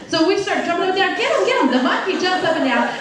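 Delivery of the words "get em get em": high, frantic repeated plea; acted hollering. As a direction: urge the trainer on in mock panic. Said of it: "we start hollering at the trainer".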